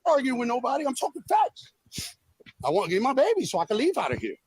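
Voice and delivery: usual speaking voice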